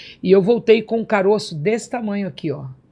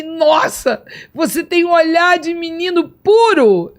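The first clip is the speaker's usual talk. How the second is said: awed exclamation; 8 semitones higher, 5 dB louder, slower than usual. Retold or imitated. Retells speaking to Jesus.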